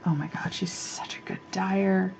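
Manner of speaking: breathless admiring whisper. Real, not acted. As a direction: whisper it like a secret crush. Gushing aside about yarn dyer.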